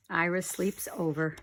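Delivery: ordinary delivery, nothing marked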